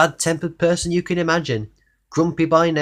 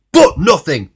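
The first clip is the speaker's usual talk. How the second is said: loud, high indignant grumble; grumpy retort; 4 semitones higher, 11 dB louder than usual. Acted. Mister Grumpy snaps at Mister Happy, loud and cross. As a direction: snap it back in disgust. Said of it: "went on Mister Grumpy".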